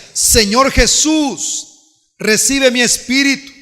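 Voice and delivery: slow, high, loud cry to Jesus; preacher voicing Esteban crying out to the Lord as he dies; calling out to God. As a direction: stretch it out like a dying man's prayer. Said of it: "él invocaba y decía".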